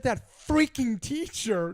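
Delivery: retold angry blast at the teacher; angry outburst. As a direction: spit out the words at the teacher.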